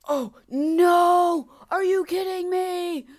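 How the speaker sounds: loud, high, drawn-out yell; outraged shout. Real, not acted. Player fails late in the level, outraged shout. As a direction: shout it out in disbelief.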